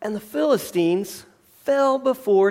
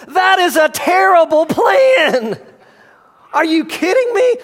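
much higher and louder, half-laughing disbelief; incredulous outburst; 8 semitones higher, 5 dB louder than usual. Real, not acted. Preacher to congregation, mocking a terrible plan, laughing outburst. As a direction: blurt it out like you can't believe it.

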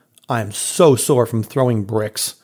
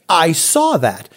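much higher, slow, incredulous; exasperated pitched-up scolding; retold exasperation; 8 semitones higher, slower than usual. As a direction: spell out his failure, pitched up. Arguing with the contractor.

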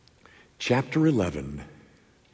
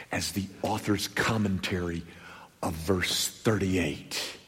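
whisper; 5 semitones lower, 10 dB quieter than usual. Acted. Preacher hushing the congregation on the key verse, whispered. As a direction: let it fall to a whisper. Drops to a hush.

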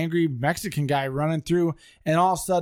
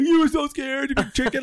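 higher, teasing jeer; mocking tease; 9 semitones higher than usual. Brother taunting him after the scare, mocking. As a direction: rub it in, laughing. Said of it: "starts laughing at me".

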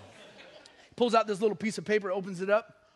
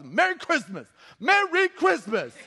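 loud greeting building higher and slower; pointed greeting; 8 semitones higher, 7 dB louder, slower than usual. Retold or imitated. Preacher greeting strangers in the mall, loud and pointed. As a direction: make a point of it, louder each time. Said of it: "I started making a point".

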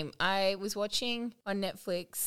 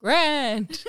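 loud, higher, theatrical burst through laughter; operatic; 4 semitones higher, 6 dB louder than usual. Acted. Replaying her own big gesture for laughs, operatic. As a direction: proclaim it like an opera singer. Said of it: "like she was in an opera".

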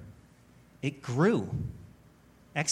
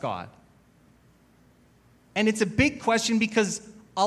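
raised, louder sermon voice, driving the point home; earnest preaching; 4 semitones higher, 3 dB louder than usual. Acted. Pastor urging the congregation to trust God, earnest preaching. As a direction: preach it with conviction, lifting each phrase.

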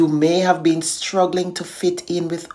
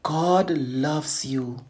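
soft, breathy whispered words; hushed whisper of comfort; 3 semitones lower, 6 dB quieter, slower than usual. A narrator's reading. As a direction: whisper it gently like a voice of hope. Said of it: "Then comes a whisper".

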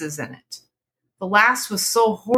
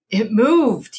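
a mild startled cry, a bit louder; cried out; 4 semitones higher, 4 dB louder than usual. Acted. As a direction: blurt it out, shaken. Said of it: "he cried".

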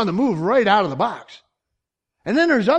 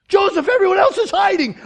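high, loud pleading protest; alarmed objection; 13 semitones higher, 6 dB louder than usual. Acted. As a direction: play a worried objector trying to stop someone. Acted objection to Joseph.

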